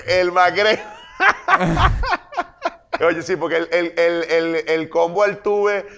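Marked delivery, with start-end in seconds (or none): 1.2-2.7 high laugh. Real, not acted. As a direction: cackle high and hard. Laughs at sandwich joke. (carcajada aguda)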